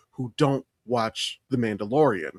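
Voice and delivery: ordinary delivery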